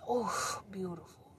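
normal talking voice

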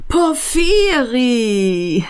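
slow, high drawn-out call of a name; fat man calling out. A narrator's reading. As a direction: call across the platform to an old friend. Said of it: "rief der Dicke".

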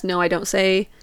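usual speaking voice

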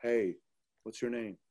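soft, smooth, low-key hello; smooth pickup line. Retold or imitated. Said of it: "He would talk to girls like this".

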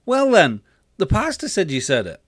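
put-on high, insistent kid; arguing boy. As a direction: argue back as a child, loud at the start. Boy argues back.

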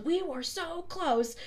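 slow, high mock-dejected Hades line; quoting Hades. Hades groaning to his sidekicks, slow and high. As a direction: draw it out high like a deflated villain. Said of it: "at the end when he goes".

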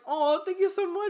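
high, loud, gushing thanks; delighted gushing. Retold or imitated. Driver thanking a stranger for a compliment, high delighted gush. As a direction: squeal your thanks, playfully heightened. Acts own delighted reply.

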